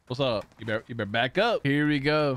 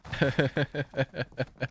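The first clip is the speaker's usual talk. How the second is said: rapid chuckling run; amused laugh; 3 dB quieter than usual. Real, not acted. Streamer amused mid-game, rippling laugh. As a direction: laugh in a long steady ripple.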